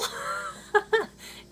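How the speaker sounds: squeaky laugh, nearly breathless; high burst of laughter. Real, not acted. Vlogger cracking up at his own Google joke, high giggle.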